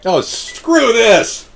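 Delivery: exasperated, high and loud, dragged out; fed-up outburst. Real, not acted. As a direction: give up on the phone menu in disgust. Frustrated with automated phone menu.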